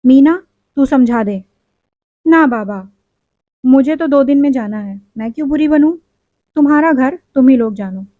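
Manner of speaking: plain reading voice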